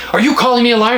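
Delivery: high-pitched mock outrage; indignant salesman. Repeating the salesman's offended challenge on the phone, high and indignant. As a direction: play the salesman, affronted. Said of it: "did go".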